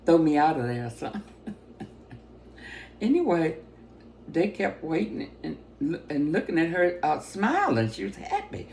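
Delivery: normal talking voice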